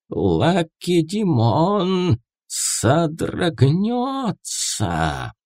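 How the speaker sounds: high, very slow hissed prophecy; hissing prophetess. Acted. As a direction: hiss it like a possessed seer. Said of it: "прошипела Пифия".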